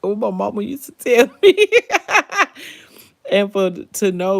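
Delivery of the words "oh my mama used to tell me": breaks into a loud laugh mid-line; real laugh at the memory of mama; a fond, loud burst of laughter. Real, not acted. Laughs remembering her mama.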